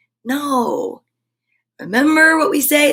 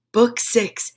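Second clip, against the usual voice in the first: ordinary delivery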